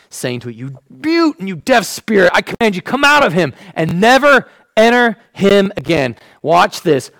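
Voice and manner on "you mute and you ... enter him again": stern command, a touch raised; rebuking. Acted. Jesus rebuking the unclean spirit, stern. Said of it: "he rebuked the unclean spirit".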